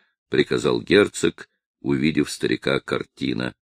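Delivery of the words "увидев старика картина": ordinary delivery, nothing marked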